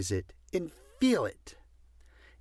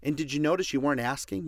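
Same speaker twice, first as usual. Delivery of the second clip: ordinary talk, nothing marked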